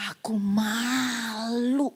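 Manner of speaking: slow, tearful, drawn-out; crying in shame. Retold or imitated. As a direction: let the shame break into tears. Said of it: "Masuk ke mobil saya nangis". (menangis malu, pelan)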